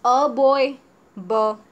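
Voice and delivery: ordinary talk, nothing marked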